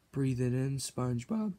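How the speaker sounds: soft hushed urging; whisper-soft coaxing; soft coaxing to SpongeBob. Acted. Coaxing SpongeBob to smell patty.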